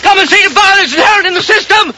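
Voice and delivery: high, hammed-up call for help; mock cry for help. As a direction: wail like a martyr to the crowd. Peasant hams up a cry for help.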